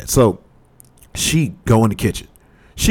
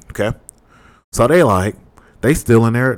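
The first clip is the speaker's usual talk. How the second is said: normal talking voice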